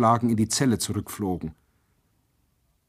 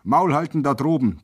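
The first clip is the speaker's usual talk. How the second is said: high, raised menacing command; threatening order; 6 semitones higher than usual. A narrator's reading. Soldier below warns singing prisoners, threatening. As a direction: threaten them from down in the yard. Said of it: "drohte es noch einmal".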